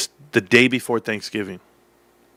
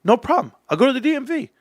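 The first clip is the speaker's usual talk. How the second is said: breezy and carefree; 13 semitones higher than usual. Retold or imitated. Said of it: "And I go".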